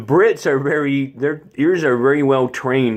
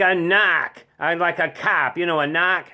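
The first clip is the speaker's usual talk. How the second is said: put-on Boston accent, higher, "knock" for narc with no R; 4 semitones higher than usual. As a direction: insist in a mock Boston voice. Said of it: "one of those accents".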